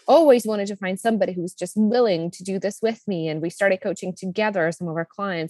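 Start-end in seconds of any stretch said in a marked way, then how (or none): none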